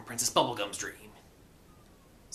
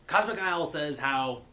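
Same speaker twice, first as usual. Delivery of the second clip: usual speaking voice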